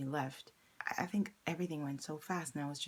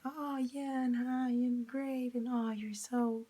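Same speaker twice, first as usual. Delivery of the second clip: high, slow, drawn-out gushing; imitated friendliness; 7 semitones higher, 4 dB louder, slower than usual. Market man going back to compliments, imitated friendliness. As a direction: mimic him gushing sweetly, pitched well up. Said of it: "he kept back to being like".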